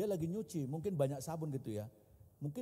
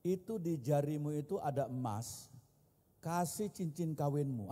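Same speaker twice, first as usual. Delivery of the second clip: normal talking voice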